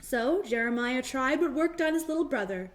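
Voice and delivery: ordinary delivery, nothing marked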